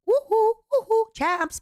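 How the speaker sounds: high, loud woohoo; excited call. Pretend. Streamer hooting to lure a lurker out, high and loud. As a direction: hoot to draw them out. Calling a lurking chatter.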